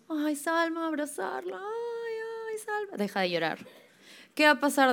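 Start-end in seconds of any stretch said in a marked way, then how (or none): 0.1-3.6 high, sugary mock consoling of a crying girl; cooing at crying child. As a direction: coo over her like everyone does, much higher. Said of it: "todos vamos". (imitando a adultos que consuelan con voz aguda y melosa)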